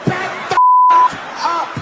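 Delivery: high-pitched crazed shout, building to a shriek; flipping out. Acted. Cornered man orders the bus to back off, flipping out. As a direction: shout the crowd back as if losing it. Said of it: "Now the guy flips out".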